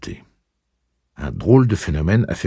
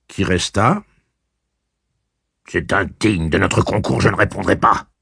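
cocky taunting; 7 semitones higher, 5 dB louder than usual. Acted. Eddie teasing Blaine with riddle.

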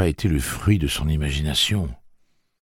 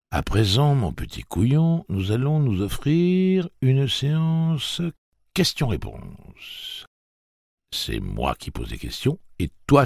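higher, slow mocking singsong; mock-sweet menace; 11 semitones higher, slower than usual. A narrator's reading. Tic-Tac mocking Jake, high singsong menace. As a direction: taunt him in a sweet voice.

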